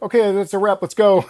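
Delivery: loud, high call to wrap it up; hurried crew shouting that's a wrap. Retold or imitated. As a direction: shout it like a director ending the take.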